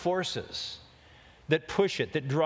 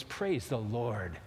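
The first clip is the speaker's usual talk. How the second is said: lower, softer, slow breath of relief; relieved sigh; 5 semitones lower, 4 dB quieter, slower than usual. Acted. Rider at the cliff edge, slow soft relieved sigh. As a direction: exhale it, shaken but saved. Wipes sweat after near fall.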